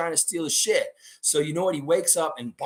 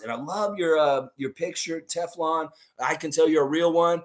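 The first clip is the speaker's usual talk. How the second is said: ordinary talk, nothing marked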